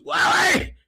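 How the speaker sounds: very high-pitched mock shout; imitated calling out. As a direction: holler the name way up high. Acts out chatter calling Wally.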